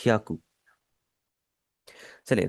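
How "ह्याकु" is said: ह्याकु with the final ku clipped short. Shown as a demonstration. Teacher models ह्याकु for students, final u clipped short. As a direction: say ह्याकु as two quick syllables, hya-ku. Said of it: "थोड़ा सा आप इसे छोटा कर ले".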